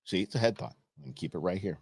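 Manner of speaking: low, muttered under his breath; hushed grumble he keeps to himself; muttered aside. Real, not acted. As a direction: bite your tongue and mumble it to yourself. Muttering aside to himself, holding back.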